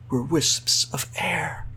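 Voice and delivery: slow, airy whisper; drawn-out, soft whispering; hushed whisper. A narrator's reading. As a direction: let the words dissolve into breath. Fingers fade to air in tale.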